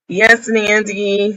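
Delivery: ordinary delivery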